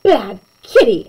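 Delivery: hammed-up telling off; mock shout. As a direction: scold the kitty. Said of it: "I shouted".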